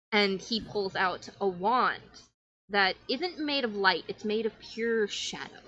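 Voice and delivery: ordinary delivery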